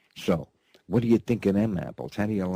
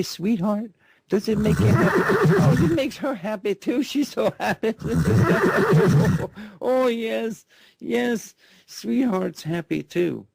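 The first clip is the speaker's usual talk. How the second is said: put-on high, giggly baby-talk coo; laughing coo; 14 semitones higher, 4 dB louder than usual. Host coos to sweetheart about being happy, laughing high. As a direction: coo at her through laughter, well above his voice.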